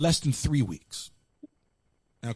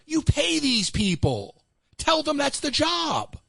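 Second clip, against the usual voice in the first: higher, slow exasperated insistence; exasperated retort; 11 semitones higher, slower than usual. Retold or imitated. Telling the boss to make workers do their job, slow and exasperated. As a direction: spell it out slowly to the boss. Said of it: "I'm like".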